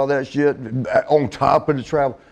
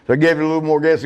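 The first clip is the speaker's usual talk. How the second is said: normal talking voice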